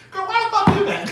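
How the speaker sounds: high, loud retold outburst; incredulous yelling. Speaker retorting to her angry friend, incredulous yell. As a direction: act out hollering back at her in disbelief.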